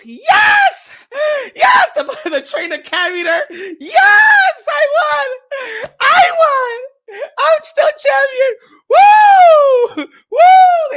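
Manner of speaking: hammed-up tearful champion celebrating; mock victory screams. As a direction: scream like you just kept the title. Imitating the winner's celebration.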